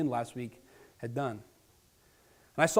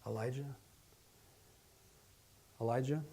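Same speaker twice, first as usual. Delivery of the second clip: much softer, gentle call of a name; still small voice; 10 dB quieter than usual. Acted. God calling Elijah by name in a soft, quiet voice. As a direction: call him softly, barely above a breath. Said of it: "God speaks to Elijah in a still, small voice".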